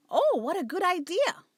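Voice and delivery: a sly cat delighted with her clever plan; delighted with herself. Acted. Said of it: "quite delighted with her own cleverness".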